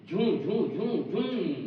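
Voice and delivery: vroom of a fast bike; playful bike noise; engine vroom imitation. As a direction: mimic a speeding engine, zhoom zhoom. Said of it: "एक गाड़ी आती है बहुत खतरनाक".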